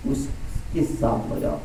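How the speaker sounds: slow breathy whisper, almost secret. Acted. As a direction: hush it slowly, as if confiding. Repeated exhortation, first take hushed. (धीमी फुसफुसाहट में)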